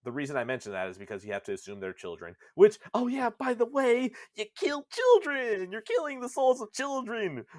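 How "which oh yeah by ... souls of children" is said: much higher and louder, a hammed-up exclamation; excited outburst. Real, not acted. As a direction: shout the shocking news with glee. Mock outrage at killing children.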